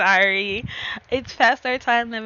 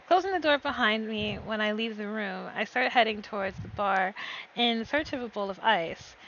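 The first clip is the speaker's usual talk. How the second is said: steady first-person storytelling; story narration; 2 semitones lower, 4 dB quieter than usual. Acted. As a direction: read the scene like a novel. Narrates her story chapter.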